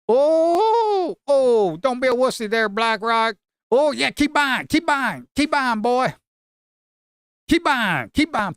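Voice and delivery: high, loud egging-on of the buyers; root for the buyers like a fan at a game; excited cheering. Real, not acted. Trader yelling at the chart for buyers to keep buying, high excited cheer.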